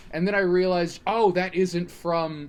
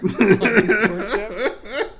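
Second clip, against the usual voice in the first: laughing; 6 semitones higher, 10 dB louder, slower than usual. Real, not acted. Laughing through punchline.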